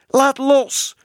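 very high, loud screamed order; shrieking child. A narrator's reading. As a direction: shriek it like a furious little girl. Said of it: "gilde Bibi". (gillend als een klein meisje)

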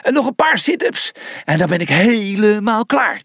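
high, strained exercising voice; straining through sit-ups; groaning with effort. A narrator's reading. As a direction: push the words out through the exertion. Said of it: "kreunde Julius".

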